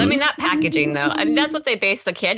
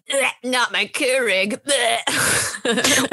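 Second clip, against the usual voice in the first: higher, grumbling disgust; grumpy Gen X voice; 5 semitones higher, much slower than usual. Retold or imitated. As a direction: whine like an old grump. Gen X voice imitated.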